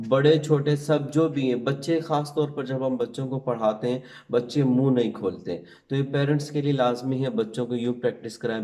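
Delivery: ordinary delivery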